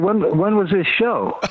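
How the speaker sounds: incredulous. Retold or imitated. Retelling disbelief at coach.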